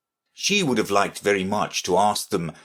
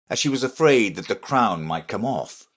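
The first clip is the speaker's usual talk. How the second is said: usual speaking voice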